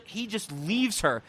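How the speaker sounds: ordinary talk, nothing marked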